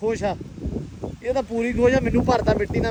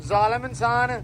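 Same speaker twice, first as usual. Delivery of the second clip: normal talking voice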